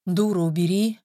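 ordinary delivery

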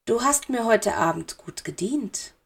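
quiet, menacing lord; soft Dark Lord. A narrator's reading. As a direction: purr the praise. Dark Lord speaks softly.

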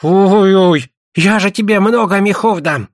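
high-voiced character begging with an offer; pleading suitor. Suitor pleads, offering many furs.

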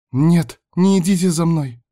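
put-on high, loud girl's plea; frightened cry. A narrator's reading. Girl flees pursuing cultists.

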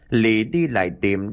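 plain reading voice

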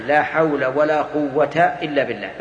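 slow, with قوة said as "quwwata"; measured citation, no n sound after قوة; example without tanween. Shown as a demonstration. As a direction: recite slowly, قوة ending in a bare -ta. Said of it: "المثال اللي معنا يا أخي".

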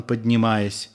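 usual speaking voice

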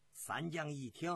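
ordinary delivery, nothing marked

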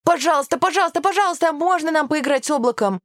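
high-pitched eager begging; pleading children. A narrator's reading. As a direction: beg teacher like an excited little kid. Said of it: "сложив ладони в мольбе".

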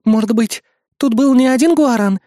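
high-pitched assistant voice; assistant's suggestion. A narrator's reading. Assistant speculating about hunters, high and alert. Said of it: "предположила помощница".

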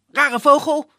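loud, high exasperated yell; annoyed shout. Acted. Dimitri shouting at the odd bird, annoyed. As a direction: shoo the bird away.

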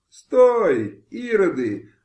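high, loud cry to stop them; a woman's desperate shout. A narrator's reading. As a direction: fling it out from the rooftop. Matryona cries out to stop them.

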